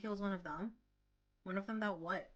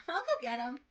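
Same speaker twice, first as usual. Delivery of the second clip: put-on bubbly girl voice; 5 semitones higher, 8 dB louder than usual. Nini voiced in story.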